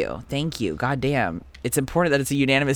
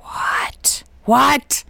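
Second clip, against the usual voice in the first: stunned whisper, rising; whispered disbelief; 11 semitones higher than usual. Real, not acted. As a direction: whisper in shock.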